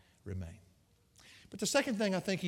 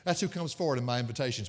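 usual speaking voice

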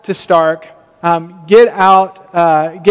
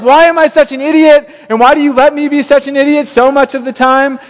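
high, loud, exasperated plea to God; half-laughing rant at himself before God; mock angry prayer; 7 semitones higher, 3 dB louder than usual. Preacher retelling his frustrated prayer to God, mock angry and high. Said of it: "I was angry at God 'cause I was like".

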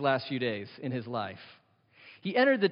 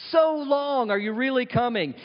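impatient doubting plea; 7 semitones higher than usual. Acted. Voices the weary waiting believers.

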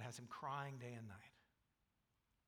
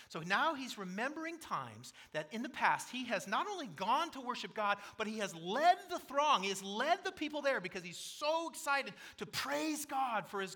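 much higher and louder, fervent; preaching at full force; 9 semitones higher, 9 dB louder than usual. Real, not acted. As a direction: lift the congregation with rising fervour. Preacher builds to climax.

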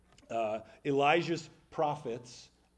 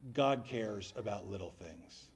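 calm answer; 6 semitones lower than usual. Retold or imitated. A professor answering the question, slow and calm. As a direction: let it land gently like a wise teacher. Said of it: "He sat back and he said".

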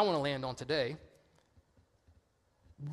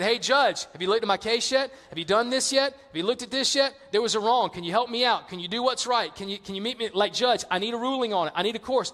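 pestering widow; 3 semitones higher, 4 dB louder, faster than usual. Acted. Widow badgering the judge at the courtroom, insistent and pestering. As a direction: pester relentlessly, one request on top of another. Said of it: "here's this woman saying".

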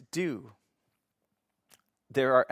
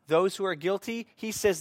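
rising preaching fervor; 6 semitones higher than usual. Acted. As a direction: preach it like the verdict is coming. Preaching build to verdict.

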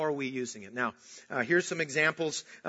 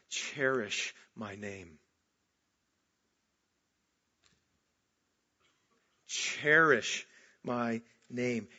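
drawn out low and slow; slow, solemn; 4 semitones lower, much slower than usual. Acted. God pleading with his people, slow and solemn. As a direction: weigh each word slowly and reverently. God's tender plea, slowed for weight.

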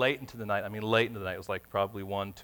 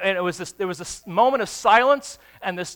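normal talking voice

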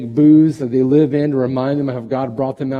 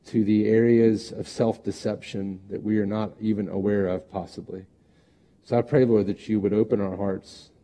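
prayer; 4 semitones lower than usual. Acted. Leads closing prayer.

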